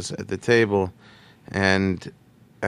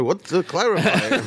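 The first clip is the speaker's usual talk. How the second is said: higher, exasperated imitation; exasperated comedian; 9 semitones higher than usual. Comedian on second call, exasperated higher imitation. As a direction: toss it back, fed up. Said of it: "second clarification call".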